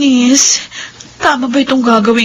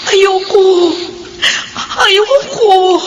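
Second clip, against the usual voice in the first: tearful, raised no; crying refusal; 4 semitones higher, much slower than usual. Acted. Widow refusing husband's farewell, crying. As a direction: refuse through sobs. Julie refuses to forget Dennis.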